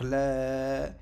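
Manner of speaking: LA drawn out on one note; prolonged vowel. Shown as a demonstration. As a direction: hold the vowel of LA. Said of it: "avec la prolongation du alif".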